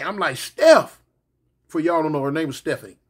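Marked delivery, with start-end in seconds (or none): 0.4-1.0 calling out. Retold or imitated. Calling out for his girlfriend.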